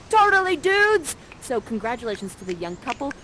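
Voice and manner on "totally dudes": loud, high dude cheer; Ferdinand. Acted. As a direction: whoop it like a thrilled young dude. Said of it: "And Ferdinand is like".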